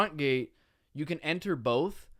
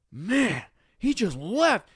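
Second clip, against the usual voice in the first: higher, louder officer's exclamation; raised, exasperated retelling; retold indignant exclamation; 8 semitones higher, 6 dB louder, much slower than usual. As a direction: imitate an officer's disbelief. Officer exclaims over fleeing driver.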